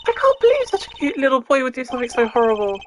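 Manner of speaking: put-on squeaky high-pitched young girl, eager; high girly voice. Streamer voicing the spunky girl sidekick, high-pitched. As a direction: pitch way up into a bright girl's voice.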